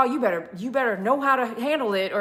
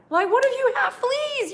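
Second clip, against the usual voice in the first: high mock scolding; 12 semitones higher than usual. Scolding the dog.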